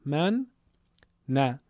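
"من، نه" as two syllables with a clear pause; split syllable reading. Shown as a demonstration. Teacher breaking down the doubled letter, separate syllables "من، نه". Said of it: "اگه به حالت اولیه در بیاد".

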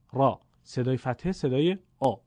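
ordinary delivery

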